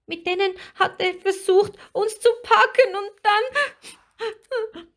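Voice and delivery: very high, agitated little mermaid; frightened account climbing towards sobs; small high voice, breaking into crying at the end; tearful. A narrator's reading. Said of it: "die kleine Nixe schluchzte von neuem los".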